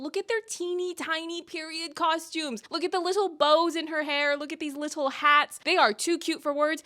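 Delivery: high-pitched gushing over cuteness; cooing. Real, not acted. Fan gushing over the Mondrich kids' costumes, cooing high. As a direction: coo over tiny adorable things.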